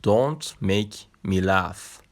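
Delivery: very slow, every word laid out clearly; slow teaching demo. Shown as a demonstration. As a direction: spell out "Don't make me laugh." slowly for learners. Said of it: "نگاه کن". (تلفظ خیلی آهسته و شمرده)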